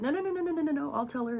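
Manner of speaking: high-pitched string of retold no's; frantic refusal. Niece stopping aunt from telling mom, frantic refusal. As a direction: cut them off with a high, urgent protest.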